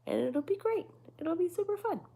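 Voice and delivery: high, chirpy sing-song promise; sing-song cheer. Real, not acted.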